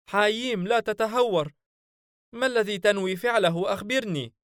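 high, anxious companion voice pleading with his master; a nervous spirit begging for reassurance, voiced high; worried jinn sidekick.

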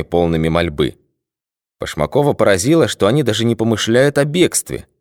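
ordinary delivery, nothing marked

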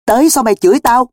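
loud, high baffled question; bewildered and indignant. Acted. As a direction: protest in total puzzlement. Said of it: "Cường ngơ ngác".

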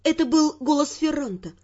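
ordinary talk, nothing marked

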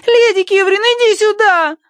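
put-on high child's voice shouting; yelling child. A narrator's reading. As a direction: shout it at the top of her lungs. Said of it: "надрывалась Агнес".

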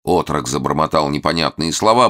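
plain reading voice

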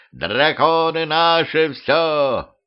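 high, slow triumphant whoop; drunken cheer. Acted. Пьяный дед ликует с дракона, протяжный высокий выкрик. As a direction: crow it out from the dragon's back. Grandpa shouting from dragon.

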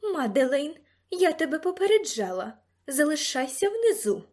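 high, loud stern warning; imperious command. A narrator's reading. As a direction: lay down the law to her. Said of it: "наказових інтонацій леді Арісти".

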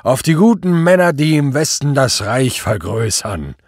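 loud, high-starting toast; tipsy command. A narrator's reading. Drunken superior toasts the troops in front of his officer, commanding. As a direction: proclaim a toast with drunken force. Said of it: "befahl der Obergruppenführer".

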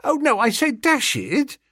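high, rapid upper-class twit pleading; a flustered young gentleman protesting; flustered toff. Acted. Bertie pleads with policeman.

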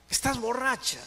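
high-pitched demand; acted challenging question. Husband testing whether his wife is drunk, acted challenge. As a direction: question her sharply, pitch leaping up.

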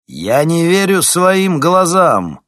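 loud, high and slow exclamation; booming man's voice. A narrator's reading. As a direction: boom it out in disbelief. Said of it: "раздался звучный мужской голос".

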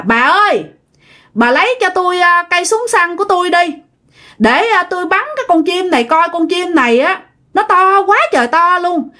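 high-pitched call to the wife, meant to be overheard. A narrator's reading. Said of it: "mà nói lớn lắm nha".

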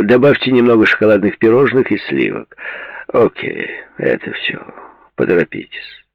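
loud, brash rich man on the phone; Conrad's voice. A narrator's reading. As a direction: bark the order down the phone.

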